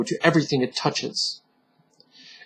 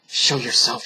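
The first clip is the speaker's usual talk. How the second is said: hissed; 5 semitones lower, 6 dB quieter than usual. Acted. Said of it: "he hissed".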